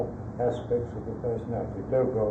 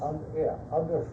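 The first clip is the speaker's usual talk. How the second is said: normal talking voice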